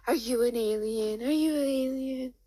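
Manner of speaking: high, sing-song cooing; acted doting parent voice; playful baby talk. John playing with baby.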